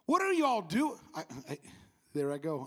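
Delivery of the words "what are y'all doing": Southern drawl. Acted. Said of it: "I'm Southern".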